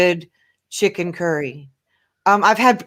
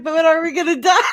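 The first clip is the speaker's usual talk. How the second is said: laughing; 9 semitones higher, 4 dB louder, faster than usual. Retold or imitated. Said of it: "I was like".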